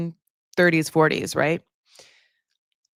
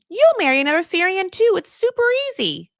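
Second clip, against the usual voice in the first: much higher, louder mock grandmother; grandma's insistence; 13 semitones higher, 4 dB louder than usual. Grandmother urging granddaughter to marry a Syrian, high and loud. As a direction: squeal it like a pushy grandma. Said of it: "she was like".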